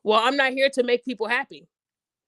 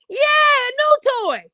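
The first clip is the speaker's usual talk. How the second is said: much higher, louder, slow delighted cheer; mock kid excitement; 12 semitones higher, 6 dB louder, slower than usual. Mocking a child thrilled with a new toy, high and cheering.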